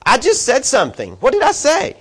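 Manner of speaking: put-on high, squeaky protest of a kid brother; mock-innocent little brother, voice cracking up high; whiny little brother. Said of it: "little brother is sitting there going".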